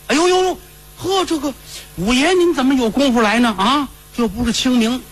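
high, fussing servant's greeting; surprised grave keeper. Acted. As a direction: pitch it up in delighted surprise.

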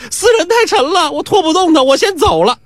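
high-pitched woman's voice; sly woman. Acted. The woman runs off with the coins, high-pitched and sly. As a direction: chirp it out in a high voice as she runs off.

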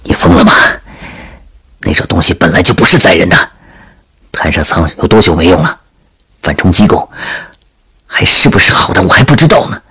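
low, hushed confidential voice; worried veteran soldier. Acted. Soldier crouched with the princess, low hushed objection. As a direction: keep it low so others don't overhear.

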